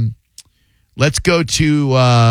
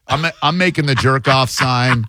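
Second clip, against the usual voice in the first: normal talking voice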